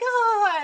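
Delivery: high, drawn-out romantic "ah"; swooning sigh. Acted. Viewer wanting a love story, high swoon. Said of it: "love story where you're like".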